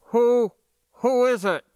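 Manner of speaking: startled voice from inside a suit; muffled voice. Acted. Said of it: "came a thickly muffled voice".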